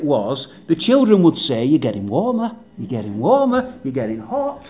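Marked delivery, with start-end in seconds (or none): 1.7-4.6 put-on soft, hushed child-like hinting; hushed teasing hint. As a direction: softly tease them that they're getting closer. Children guiding father in hiding game.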